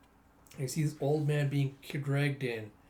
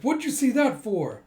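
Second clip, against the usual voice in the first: much higher, loud exasperated snap; angry outburst; 9 semitones higher, 7 dB louder than usual. Acted. Kay rebuking Figure for his grim words, loud. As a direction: throw the question back angrily.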